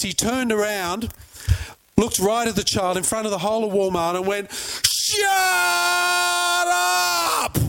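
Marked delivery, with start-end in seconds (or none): 4.5-7.6 long, high-pitched mock roar; incensed yell. Incensed man turning on a child at Walmart, high drawn-out yell. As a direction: stretch it into a high imitated bellow. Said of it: "this guy got so incensed".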